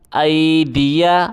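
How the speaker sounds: ordinary delivery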